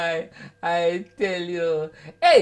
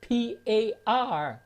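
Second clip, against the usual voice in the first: 1 slow spelled letters; much slower than usual. Retold or imitated. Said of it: "If you want peas and rice".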